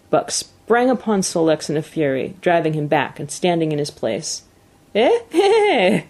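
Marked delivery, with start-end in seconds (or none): 4.9-6.0 high, loud gleeful cackle; acted whoop of amused glee; gleeful cry. A narrator's reading. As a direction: crow with delight, high-pitched. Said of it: "Francois cried, slapping his thighs gleefully".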